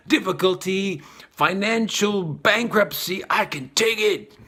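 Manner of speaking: hammed-up dare to fate; boastful shout. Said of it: "you're like".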